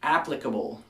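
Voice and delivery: applicable said as ap-pli-ca-ble, stress on AP; pronunciation demo. Shown as a demonstration. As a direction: applicable with the first syllable stressed. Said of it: "many people pronounce it applicable".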